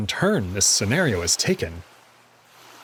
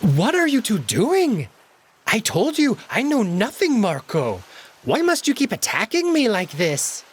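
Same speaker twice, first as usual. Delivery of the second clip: whiny victim; 10 semitones higher than usual. Acted. Alex protesting innocence to Marco, high whine. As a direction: play the wronged innocent.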